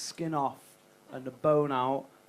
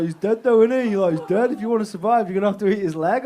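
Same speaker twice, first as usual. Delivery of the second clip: loud survivors; 5 semitones higher, 11 dB louder than usual. Retold or imitated. Survivors telling him to eat Dave.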